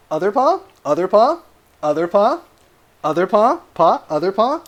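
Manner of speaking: high, rising cue to a dog; dog command. Real, not acted. Owner asks the dog for the other paw, high rising command. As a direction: coax the dog with a lifted, questioning end.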